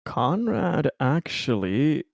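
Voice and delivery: normal talking voice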